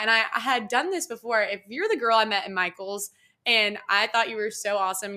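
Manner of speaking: ordinary delivery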